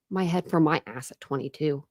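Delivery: ordinary talk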